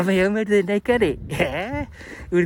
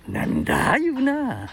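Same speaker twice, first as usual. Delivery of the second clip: ordinary talk, nothing marked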